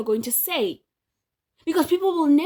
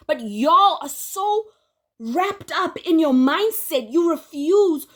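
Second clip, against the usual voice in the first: exasperated rant; 5 semitones higher, 5 dB louder, slower than usual. Real, not acted. Ranting at critics, slowed.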